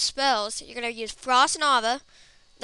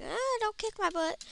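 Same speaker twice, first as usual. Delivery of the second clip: high-pitched whimper, played for laughs; mock pleading guard; 9 semitones higher, 4 dB quieter than usual. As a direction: plead in a squeaky put-on panic. Guards begging in retold fight.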